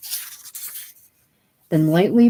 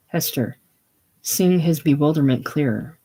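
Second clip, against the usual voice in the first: ordinary talk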